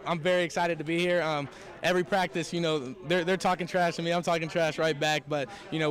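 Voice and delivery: ordinary talk, nothing marked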